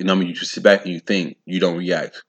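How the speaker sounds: normal talking voice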